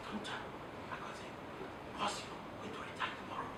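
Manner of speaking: hushed, conspiratorial whisper. Shown as a demonstration. Confiding in the boss to gain credibility, whispering. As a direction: whisper it like a big secret for the boss. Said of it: "All you did was you whispered".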